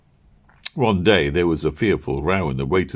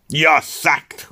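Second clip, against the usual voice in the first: fired in a hiss; 9 semitones higher, 4 dB louder than usual. Acted. Manager fires the waiter.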